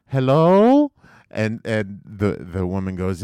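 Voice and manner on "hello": put-on high, loud little girl answering the phone; imitating a young girl picking up; young girl's voice. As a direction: pick up like a young girl, much higher. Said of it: "she's a young voice".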